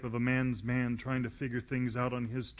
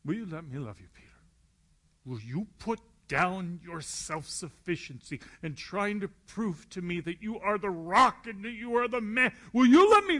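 higher and louder as the plea builds; rising impassioned plea; 8 semitones higher, 6 dB louder than usual. Acted. Jesus begging Peter to let himself be loved, building to a loud cry. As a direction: push the plea up until it peaks.

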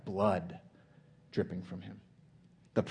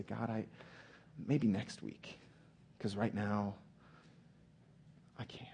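soft, halting excuse; hesitant excuse; 10 dB quieter than usual. Acted. Preacher voices Jesus tempted to put God off, softly hesitant. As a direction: back out quietly, with long pauses.

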